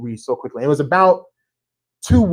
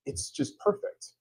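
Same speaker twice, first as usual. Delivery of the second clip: whisper; 6 semitones higher, 11 dB quieter, slower than usual. Acted. Describing a serene duck.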